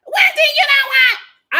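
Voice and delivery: high, loud mock yell; mock shout. Kiana suddenly turning on Wendy, high loud mock yell. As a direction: blurt it out of nowhere like picking a fight. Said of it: "Kiana got a, a problem".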